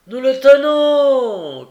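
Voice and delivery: high, drawn-out yell; triumphant shout. A narrator's reading. Gilles calling up from the foot of the stairs, shouting. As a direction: call it out at full voice. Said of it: "cria Gilles du bas de l'escalier".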